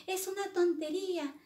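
put-on high-pitched girl complaining; fed-up Alicia. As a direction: protest in a high girlish voice. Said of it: "se cansó y dijo".

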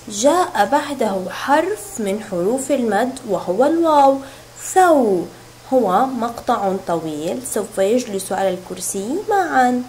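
ordinary talk, nothing marked

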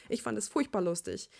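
ordinary delivery

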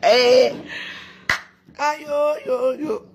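high, drawn-out "aïe yo yo"; wailing exclamation. Real, not acted. Streamer scoffing at her critics, high wail.

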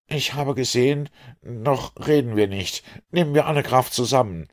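a lightly acted struggle to get words out; faltering stammer. A narrator's reading. Exhausted Conseil in the sea urges saving strength, faltering stammer. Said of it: "stammelte er".

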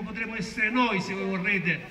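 ordinary talk

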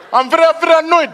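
high, loud, quick fake anger; hammed-up annoyance. Auditioner straining to convince judges he is angry, high mock annoyance. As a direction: push the annoyance hard and fast, badly acted. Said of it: "show me that you're angry".

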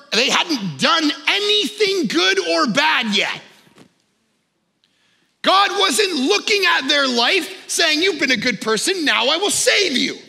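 high, slow, emphatic sermon delivery; forceful preaching. Real, not acted. Pastor challenges the church's idea of being good, impassioned preaching. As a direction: drive each phrase home with rising intensity.

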